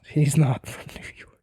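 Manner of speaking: whispered with a laugh. Real, not acted. Fan chuckling about the wrestler's fake hometown, laughing whisper. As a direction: let the laugh slip through in a whisper. Amused aside.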